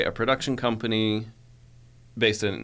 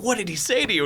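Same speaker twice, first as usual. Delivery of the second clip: much higher, eager asking; curious classmates; 12 semitones higher than usual. Retold or imitated. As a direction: ask it like nosy classmates. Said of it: "everyone's like".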